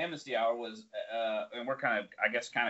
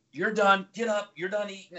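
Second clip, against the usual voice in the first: lightly acted drill instructor, raised pitch; mock instructor order; 9 semitones higher, 3 dB louder than usual. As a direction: order a trainee up in a higher voice. Instructor scolds trainee in retold quote.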